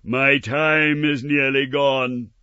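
slow loud spectral warning; urgent ghost. Acted. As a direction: warn with urgency. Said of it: "cried the ghost".

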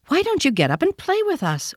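put-on high, light voice of a young wild rabbit; furry rabbit asking. A narrator's reading. Wild rabbit inviting the toy rabbit to play, high and light. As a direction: lift the pitch for a playful wild rabbit. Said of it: "one of them asked".

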